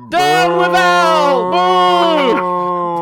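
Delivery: high, loud, drawn-out jeering; angry mob leader. Pretend. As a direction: whip up a booing crowd.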